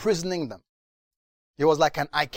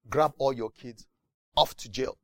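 ordinary talk

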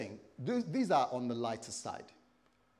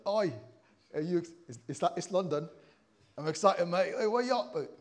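loud, higher mock East London banter; East London accent; 4 semitones higher, 6 dB louder than usual. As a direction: ham up a matey East London greeting.

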